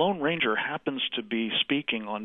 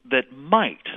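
"might" punched with a rise and fall in pitch; stress on "might"; 4 dB louder than usual. Real, not acted. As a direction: lean hard on the word "might". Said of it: "I emphasize the word might".

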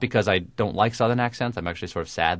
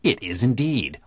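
ordinary delivery

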